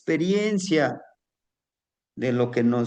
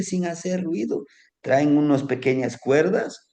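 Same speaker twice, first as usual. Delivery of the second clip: usual speaking voice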